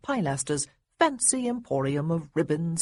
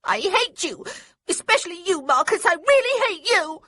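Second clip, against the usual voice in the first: high, loud furious yell; yelling girl; 13 semitones higher, 5 dB louder than usual. A narrator's reading. Little girl screaming hatred at Marcus, loud and high. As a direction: scream it at them with all she has. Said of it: "And then she yelled it".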